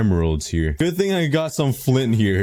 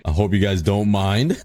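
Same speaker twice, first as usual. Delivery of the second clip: usual speaking voice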